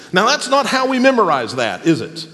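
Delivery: usual speaking voice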